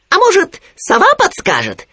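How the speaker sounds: very high, loud little animal voice; Khryunya. A narrator's reading. Khryunya visiting his friend Winnie, high piping voice. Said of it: "выдвинул предположение Хрюня".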